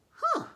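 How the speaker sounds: very high squeaky huh; high surprised huh. Acted. Zebras surprised.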